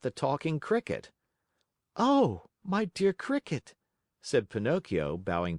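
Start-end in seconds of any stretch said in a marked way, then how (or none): 1.9-3.7 high, polite eager greeting; polite puppet. Acted. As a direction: greet an old friend sweetly.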